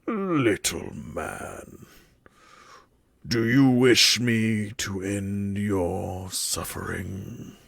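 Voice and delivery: slow low menacing offer; booming demon lord. Acted. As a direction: loom over him, slow and cold. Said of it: "and is like".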